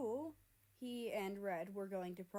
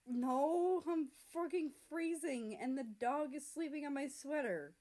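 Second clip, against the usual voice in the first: raised, whiny griping about the cold; loud complaint; 6 semitones higher, 5 dB louder than usual. Acted. America gripes loudly about freezing and the dog.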